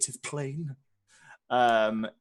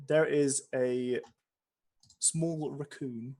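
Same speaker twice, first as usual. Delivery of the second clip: slow dramatic reveal; much slower than usual. Acted.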